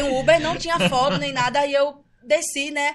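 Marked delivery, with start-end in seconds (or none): none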